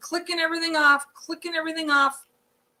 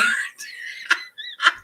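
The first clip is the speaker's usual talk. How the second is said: airy, unvoiced high laugh; breathy laugh; 4 dB quieter than usual. Real, not acted. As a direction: laugh under your breath, delighted. Laughs about TV by the pool.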